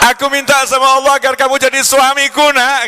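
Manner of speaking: high, loud begging mother's voice; pleading widow. Acted. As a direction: plead with him like a lonely widow.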